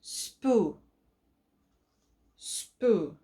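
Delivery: "Spoo" with a long oo; long U blend. Shown as a demonstration. Teacher to kids, modelling the long u in "Spoo". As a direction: draw out the oo in "Spoo". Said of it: "The long U sound".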